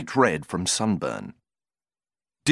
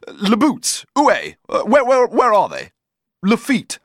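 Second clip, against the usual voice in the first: frantic pleading; 10 semitones higher, 6 dB louder than usual. Acted. Cyril growing frantic.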